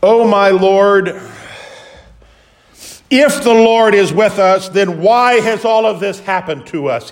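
drawn-out pleading complaint; pleading Gideon. Acted. Gideon questioning the angel, slow loud lament. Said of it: "Gideon's initial response".

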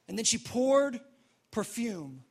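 ordinary talk, nothing marked

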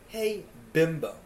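ordinary talk

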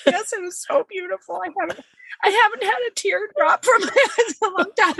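high, fast, laughing giddy gush; laughing through it. Retold or imitated. Telling her friend about first tears, laughing and high. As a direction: gush through giggles, tears half-surprised. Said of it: "I'm like".

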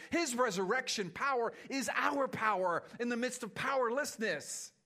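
loud, high, driving preacher voice; preaching at full force. Acted. Preacher rousing the church, loud and high. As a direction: preach it out to the congregation with fire.

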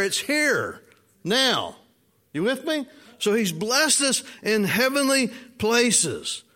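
high, slow, rising preacher's cadence; preaching. Acted. Pastor driving the point to his congregation, preaching high and slow. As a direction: lift the room with each line.

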